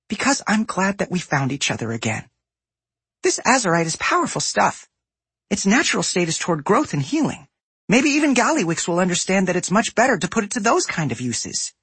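put-on high bright goblin girl; sweet eager young woman. A narrator's reading. Voices Safi.

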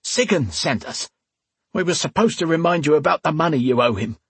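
high, strained henchman voice; cornered henchman explaining himself; thug, confessing under threat. Acted. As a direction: blurt out the confession.

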